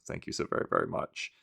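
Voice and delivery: breathy whispered thank-you. Real, not acted. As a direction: whisper it gratefully.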